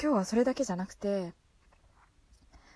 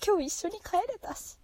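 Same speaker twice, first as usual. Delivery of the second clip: much higher, giddy with joy; thrilled; 11 semitones higher than usual. Real, not acted. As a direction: say it giddy and delighted. Repeats happy memory.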